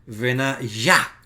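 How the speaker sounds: Benayá with the stress on the final syllable yá; final stress demo. Shown as a demonstration. Teacher showing students the final stress of Benayá. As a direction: lean on the last syllable of Benayá. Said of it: "la sílaba final tiene la acentuación".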